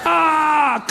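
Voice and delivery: high loud yelp of pain; howling cry of pain. Retold or imitated. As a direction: scream as you get hit. Being shot on the fence.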